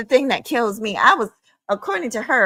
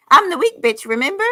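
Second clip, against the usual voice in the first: higher, louder sarcastic "weak bitch"; sarcastic mock; 5 semitones higher, 3 dB louder than usual. Vlogger mocking what critics called her, sarcastic and loud. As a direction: throw their insult back mockingly.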